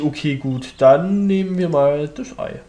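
usual speaking voice

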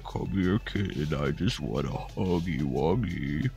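low, soft creepy croon; creepy deep voice. Retold or imitated. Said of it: "he's gonna say some stuff like".

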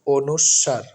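অনুস্বার in careful syllables, o-nush-shar; correct letter name. Shown as a demonstration. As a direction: sound a double sh in অনুস্বার. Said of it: "সঠিক উচ্চারণটি হচ্ছে".

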